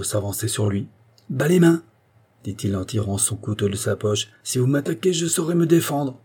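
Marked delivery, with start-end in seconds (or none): 1.3-1.8 loud, high-pitched command; sharp warning. A narrator's reading. Threatened man warns off attacker. 4.4-6.2 high, tense warning; defiant warning. A narrator's reading. Threatened man warns with a knife.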